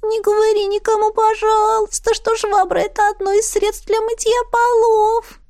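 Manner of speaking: high, begging squeak; pleading otter voice. A narrator's reading. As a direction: plead with her, high and small. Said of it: "умоляюще позвала".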